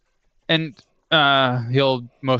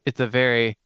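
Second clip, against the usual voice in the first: normal talking voice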